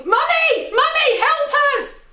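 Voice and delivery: high, loud scream for help. Retold or imitated. Child calls down to mum from the fairground ride, screaming.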